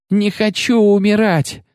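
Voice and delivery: high, slow wail of terror; desperate yell. A narrator's reading. Wounded man on a stretcher, high terrified wail. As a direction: scream it like you're about to die. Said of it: "заорал кто-то из находившихся в сознании раненых".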